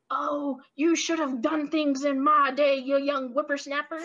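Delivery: put-on grumpy old man scolding; lightly voiced cranky old man. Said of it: "my old man voice".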